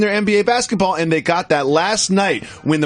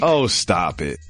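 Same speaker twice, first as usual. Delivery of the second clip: deep mock voice; 11 semitones lower, slower than usual. Repeated catchphrase low.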